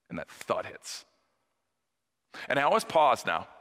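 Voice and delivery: ordinary talk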